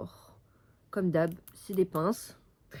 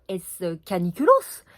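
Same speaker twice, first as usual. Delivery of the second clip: mock dramatic; 9 dB louder than usual. Jokey mock word about heatwave.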